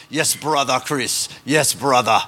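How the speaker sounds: slow imitation of an Australian accent; George Francis's Australian accent. Missionary greeting the preacher, imitated Australian accent. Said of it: "He would always talk like that".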